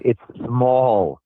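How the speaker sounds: usual speaking voice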